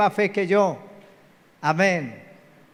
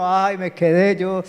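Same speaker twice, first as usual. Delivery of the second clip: mock childhood dread. Voicing own childhood fear of rapture.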